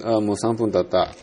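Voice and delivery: ordinary talk, nothing marked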